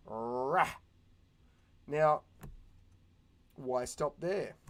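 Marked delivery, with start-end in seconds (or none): 0.1-0.7 monster roar sound. Retold or imitated. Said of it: "So now it's going".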